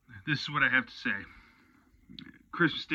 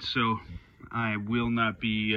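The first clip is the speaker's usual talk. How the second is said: ordinary talk, nothing marked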